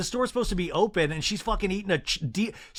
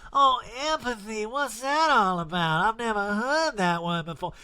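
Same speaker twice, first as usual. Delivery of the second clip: mock Erika; 3 semitones higher than usual. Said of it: "Erika, that's called empathy".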